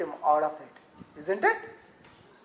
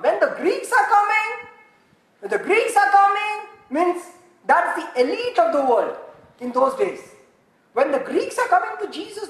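high, loud preaching, building excitement; raised-pitch sermon climax; fervent preaching; 6 semitones higher, 5 dB louder than usual. Acted. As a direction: preach it out like big news. Preaching at full force.

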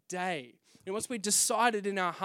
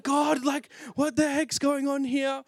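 high loud shout to God; exasperated shout; 6 semitones higher, 5 dB louder, slower than usual. Retold or imitated. Shouting at God from the overpriced apartment. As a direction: shout it in frustration.

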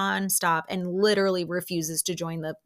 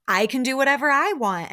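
louder, higher mock comeback without screaming; acted comeback, moderately raised voice; milder retort; 5 semitones higher, 5 dB louder than usual. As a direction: imitate a firm, controlled retort. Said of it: "Kiki is saying this calmly".

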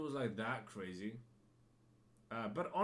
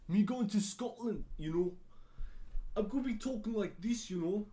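higher-pitched, amateur Scottish accent; attempted Scottish accent; 8 semitones higher, 4 dB louder than usual. Shown as a demonstration. Podcaster imagining a trip to Scotland, in an attempted Scottish accent. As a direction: have a go at sounding Scottish, pitched up. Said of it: "Is that a Scottish accent?".

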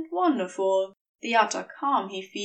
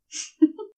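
short light chuckle, a little higher and louder; brief affectionate laugh; soft fond laugh; 7 semitones higher, 4 dB louder than usual. A narrator's reading. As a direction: laugh fondly at him. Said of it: "Crowley laughs".

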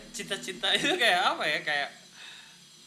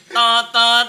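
loud honked "tot, tot"; horn-like censor sound; 4 semitones higher, 14 dB louder than usual. Shown as a demonstration. Suggesting another censor sound to friends, loud honk imitation. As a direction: blast it like a truck horn. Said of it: "kalau misalnya sensor diganti jadi".

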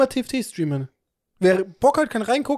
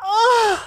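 loud high sneeze; 4 dB louder than usual. Real, not acted. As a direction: let out a real sneeze. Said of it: "ich muss niesen".

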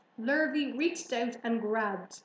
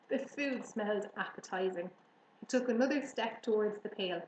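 ordinary delivery

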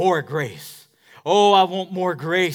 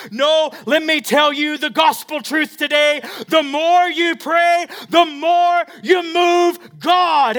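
high, loud, slow proclamation; triumphant preaching; 10 semitones higher, 8 dB louder, slower than usual. Acted. Pastor proclaiming gospel truth, loud and slow. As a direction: declare it with fire.

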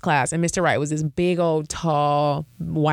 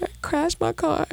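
fake crying; 11 semitones higher than usual. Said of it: "I just started crying one day".

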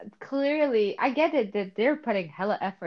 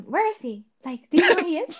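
imitating K-pop fans; 6 semitones higher, 5 dB louder than usual. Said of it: "exact voice".

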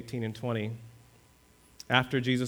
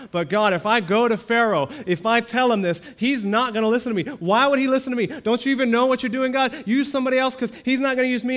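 higher, louder, fast pleading excuses; Moses pleading; 9 semitones higher, 7 dB louder, faster than usual. Acted. Moses making excuses to God, high, loud and hurried. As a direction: beg God to pick somebody else.

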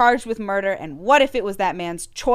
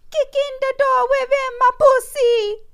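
very high, put-on screaming voice; mock scream; 4 dB louder than usual. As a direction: screech it like a wild woman kicking a door. Voicing a screaming woman.

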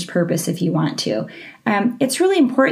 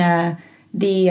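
ordinary talk, nothing marked

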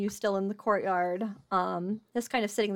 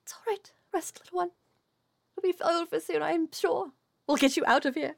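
tender reassurance; 6 semitones higher than usual. Acted. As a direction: soothe a tired little one to rest. King soothes tired fairy.

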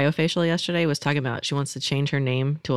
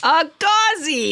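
high, drawn-out playful cry; delighted high-pitched call; gleeful exclamation; 13 semitones higher, 4 dB louder, much slower than usual. Pretend. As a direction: sing it out high and long. Gleefully calls out the coined word.

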